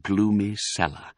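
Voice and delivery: usual speaking voice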